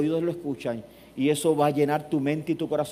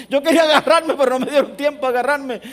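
laughing complaint; 9 semitones higher, 7 dB louder than usual. Retold or imitated. Retelling the push, amused.

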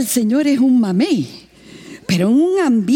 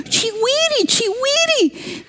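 missionary explaining; 13 semitones higher, much slower than usual. Retold or imitated. Missionary repeats the word.

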